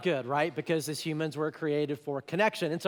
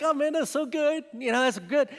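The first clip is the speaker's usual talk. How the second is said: gushing, much higher with delight over the puppies; God thrilled with the puppies, voice lifted high; 7 semitones higher than usual. Retold or imitated. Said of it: "he'd be like".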